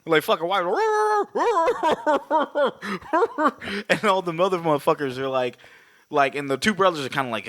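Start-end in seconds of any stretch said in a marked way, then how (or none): 0.7-3.8 high-pitched drawn-out barking noise; mock barking. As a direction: imitate a man barking like a dog. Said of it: "that much barking in my life".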